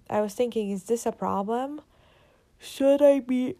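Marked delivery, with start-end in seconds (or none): 2.6-3.6 words stretched by a yawn. Real, not acted. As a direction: say it mid-yawn, drawn out. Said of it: "yawning so much".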